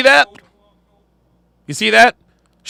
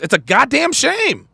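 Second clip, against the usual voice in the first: ordinary talk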